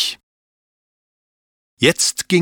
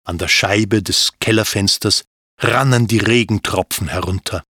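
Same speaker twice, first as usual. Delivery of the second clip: plain reading voice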